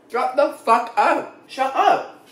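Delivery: can't believe how good it is; amazed delight. Real, not acted. Reviewer tasting the peach mango pie, amazed delight.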